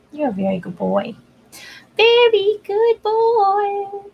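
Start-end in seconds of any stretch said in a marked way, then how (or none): 2.0-4.1 slow high doggy praise; high drawn-out praise. Real, not acted.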